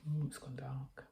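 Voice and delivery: hushed whisper, leaning in to the listeners; spooky whisper. Acted. Narrator whispering an aside to the children, hushed. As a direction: breathe it like the lights just went out.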